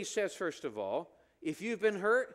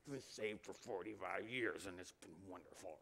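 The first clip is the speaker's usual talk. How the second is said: much lower, softer, deadpan and flat-faced; joyless monotone; 11 semitones lower, 11 dB quieter than usual. Retold or imitated. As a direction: say wonderful with no joy at all. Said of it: "Tell your face".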